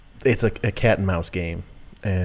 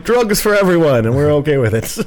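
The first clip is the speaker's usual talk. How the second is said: hammed-up carefree coach; gleeful mock coach; 6 semitones higher, 6 dB louder than usual. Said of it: "I'm like".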